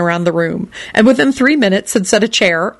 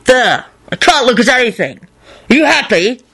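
shouting; 5 semitones higher than usual. Acted. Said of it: "he shouted at Neville".

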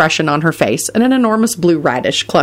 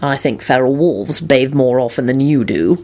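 low, rude put-down; coolly insulting; 4 semitones lower than usual. A narrator's reading. Lupin to unwashed Mundungus, rude. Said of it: "Lupin said rudely".

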